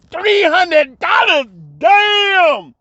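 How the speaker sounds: slow, high, loud near-sobbing cry; overjoyed disbelief. Retold or imitated. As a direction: cry it out as if you hit the jackpot. Still overwhelmed by the pay.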